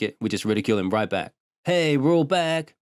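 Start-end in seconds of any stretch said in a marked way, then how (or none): 1.6-2.7 calling out. A narrator's reading. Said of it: "Shang-Chi called out".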